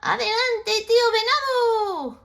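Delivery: high, loud call. Acted. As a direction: shout from ahead. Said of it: "a toad's voice ahead of him call".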